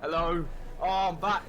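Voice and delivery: loud, happy return greeting; booming back into the call. Real, not acted. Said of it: "you're really loud on my shit".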